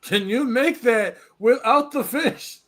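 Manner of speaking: high, slow, mocking echo of the order; mocking imitation. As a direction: parrot her question back, slow and high. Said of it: "And I was like".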